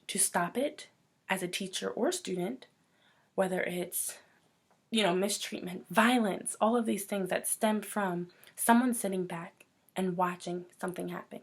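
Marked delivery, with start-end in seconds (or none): none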